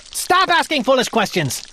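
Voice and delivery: king snapping at his page. Acted. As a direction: cut him off sharply. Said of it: "snapped the king".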